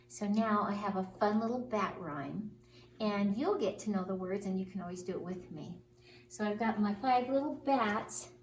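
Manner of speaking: plain reading voice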